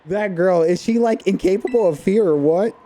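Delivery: amazed. Acted. Amazed at girl jumping.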